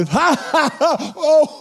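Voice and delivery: big high-pitched laugh, acted out; re-enacted laugh. Preacher showing how he heard his dad's laugh, acted laughing. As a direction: burst out in a retold belly laugh. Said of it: "I laughed out loud".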